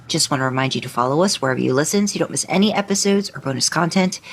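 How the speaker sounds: ordinary talk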